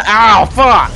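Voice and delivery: high, loud yelps; pained yell. Real, not acted. Player getting hit in the game, loud high yelling.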